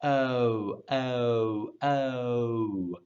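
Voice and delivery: "oh" drawn out and gliding down, fully rounded; rounded vowel. Shown as a demonstration. Teacher isolates the vowel of moan, rounded oh. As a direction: repeat a long, round "oh". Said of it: "has a roundedness to it".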